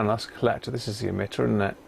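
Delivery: ordinary delivery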